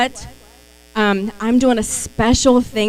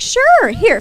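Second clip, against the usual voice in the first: much higher, eager and quick; bright eager reply; 11 semitones higher than usual. Retold or imitated. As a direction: pop up eagerly as if handing it over. Acting her own eager reply.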